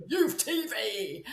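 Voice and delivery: a hint of a street porter, slow and drawn out; lightly voiced street reporter. Shown as a demonstration. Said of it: "the street porter".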